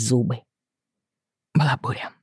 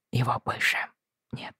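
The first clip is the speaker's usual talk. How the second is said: whisper; 10 dB quieter than usual. A narrator's reading.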